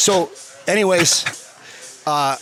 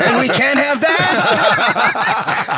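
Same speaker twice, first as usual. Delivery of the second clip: put-on heavy French accent; 5 semitones higher, 3 dB louder, much slower than usual. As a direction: still in the French accent, very slow and laughing. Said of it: "heavy French accent".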